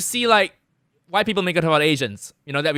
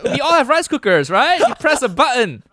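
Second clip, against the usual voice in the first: higher-pitched, amused disbelief; comic incredulity; 8 semitones higher, 3 dB louder than usual. Real, not acted. Comedian asked if they can cook rice, incredulous. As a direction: answer as if it's absurdly obvious.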